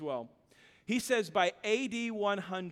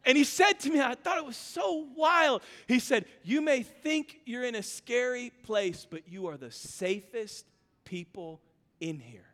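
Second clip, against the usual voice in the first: ordinary delivery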